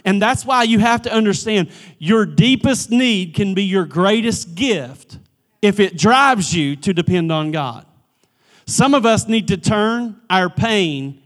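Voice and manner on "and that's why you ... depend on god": ordinary talk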